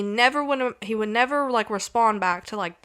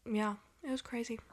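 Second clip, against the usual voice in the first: whispered; 12 dB quieter than usual. Real, not acted. Hushed remark on creepy story.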